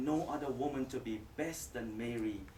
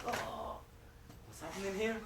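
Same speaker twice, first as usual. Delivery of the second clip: startled woman; 7 semitones higher, 6 dB quieter than usual. Acted. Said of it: "It's like any woman, you see a strange man".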